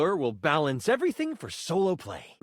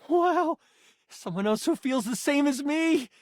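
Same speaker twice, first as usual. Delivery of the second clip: higher, thrilled wonder; delighted amazement; 9 semitones higher than usual. Acted. Game master meeting a fellow fan, delighted amazement. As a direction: marvel at finding a fellow fan.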